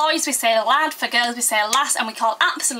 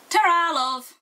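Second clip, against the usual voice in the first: higher, broad Yorkshire farewell; 5 semitones higher, slower than usual. Shown as a demonstration. Vlogger showing a really Yorkshire goodbye, broad Yorkshire accent. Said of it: "if you want to be really, really Yorkshire".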